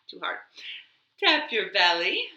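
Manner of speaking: ordinary delivery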